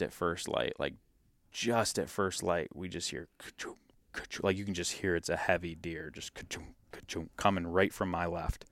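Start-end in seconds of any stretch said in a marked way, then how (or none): 2.7-4.4 breathy hushed imitation of heavy hooves crunching; whispered footstep sound. 6.1-6.7 breathy hushed imitation of heavy hooves crunching; whispered footstep sound.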